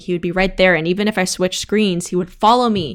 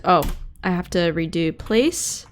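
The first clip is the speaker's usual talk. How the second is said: normal talking voice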